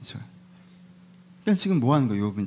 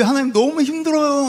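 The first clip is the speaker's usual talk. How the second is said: high, wailing complaint to God; hammed-up cry of hardship; acted weary plea; 8 semitones higher than usual. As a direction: plead upward as if worn out. Voicing Job crying out to God.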